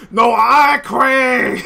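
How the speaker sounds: loud, slow, high correction; exasperated shout. Acted. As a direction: spell it out loudly in exasperation. Jimmy corrects her.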